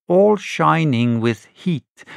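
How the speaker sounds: plain reading voice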